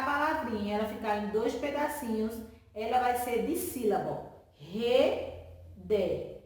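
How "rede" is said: "Rede" in two parts, re, de, with a long gap; syllable by syllable. Shown as a demonstration. Teacher giving an example of dissílaba, "Rede" broken slowly into re-de. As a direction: split "Rede" into re and de. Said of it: "Se eu separar rede".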